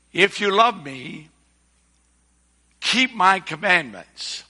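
measured, emphatic Bible reading with long pauses; deliberate pulpit reading, each phrase set apart; Scripture reading. Acted. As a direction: read the verses out solemnly to a congregation, phrase by phrase. Reads Scripture aloud to congregation.

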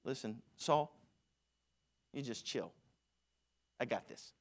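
a lightly voiced cocky teenager, low and laid-back; teen David brushing off Saul's fears; casual teen David. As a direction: tell the king to chill, easygoing. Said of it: "what he says basically here".

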